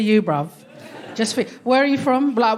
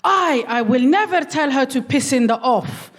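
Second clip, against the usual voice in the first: higher, accented mock indignation; imitated mum's Moroccan accent; 4 semitones higher than usual. Mum refusing to pass on insult, accented mock indignation. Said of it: "my mum was like".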